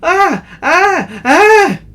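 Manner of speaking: high, loud gasps of terror; frightened high cries; terrified crying out. Acted. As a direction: cry out in panic. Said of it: "crying out".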